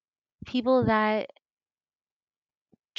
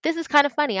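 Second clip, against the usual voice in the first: high, loud and amused, a sudden delighted thought; light up at the joke; amused realization; 9 semitones higher, 7 dB louder, much faster than usual. Retold or imitated. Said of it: "I was like".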